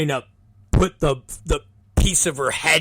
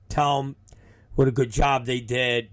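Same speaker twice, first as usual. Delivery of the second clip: ordinary delivery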